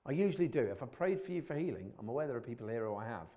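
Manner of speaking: normal talking voice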